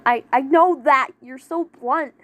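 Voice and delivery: loud flustered protest; pouting flustered. Acted. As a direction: pout it out indignantly. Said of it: "Lion pouted".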